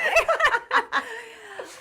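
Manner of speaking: high real laugh; host laughing. Real, not acted. Host laughing at the guest's joke, real laugh. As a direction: let a bright laugh out. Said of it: "really big laughs".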